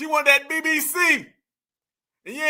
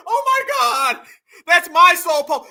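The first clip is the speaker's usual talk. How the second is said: high, loud, hammed-up outrage; mock upset woman; 6 semitones higher, 7 dB louder than usual. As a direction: shriek in drawn-out mock dismay. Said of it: "She's sitting there just upset".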